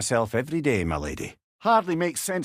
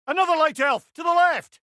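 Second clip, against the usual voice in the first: higher, louder shouted warning; urgent shout to a comrade; battle callout; 14 semitones higher, 4 dB louder than usual. Acted. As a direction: yell the warning across the fight. Calling out an enemy position.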